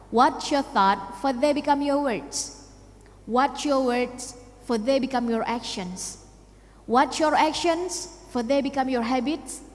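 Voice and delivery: slow, deliberate recital in English; quotation recital. Acted. Speaker quoting a prime minister to young listeners, slow recital. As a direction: recite it slowly, phrase by measured phrase.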